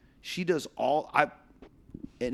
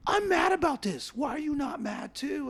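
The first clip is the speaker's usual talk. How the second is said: mock upset wife. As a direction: play the wife demanding he share her anger. Wife voiced in a quarrel.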